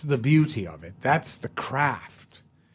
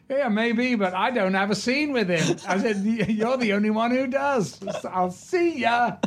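cheeky laughing reply; 10 semitones higher than usual. Retold or imitated. His own cheeky reply, laughing.

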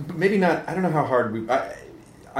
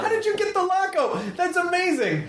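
very high, squealed disbelief; gushing amazement. Pretend. Host marvels at guest's luck, high-pitched gushing amazement.

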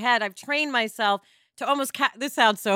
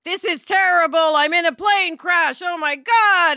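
loud, slow, hammed-up panic; mock panic; 4 semitones higher, 7 dB louder, slower than usual. Host imitating a panicked thinker, loud mock panic. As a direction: play a panicked crash victim. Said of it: "'Cause if you just think".

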